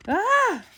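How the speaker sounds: loud high startled scream; startled shriek; shocked high scream. Acted. Shock finding substitute.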